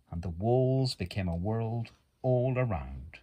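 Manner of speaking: plain reading voice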